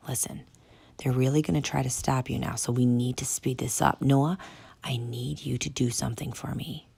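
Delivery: soft furtive whisper. Acted. Tank whispering to the kids, secret whisper. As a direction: keep it hushed.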